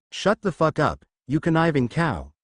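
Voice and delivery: ordinary delivery, nothing marked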